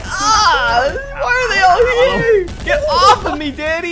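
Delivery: high, loud panicked yell. Real, not acted. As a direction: scream while being attacked. Dads attack him.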